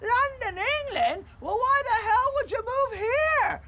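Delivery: high-pitched, astonished questioning; incredulous. Acted. Neighbour baffled that someone left London for here, incredulous. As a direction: pitch it way up in disbelief.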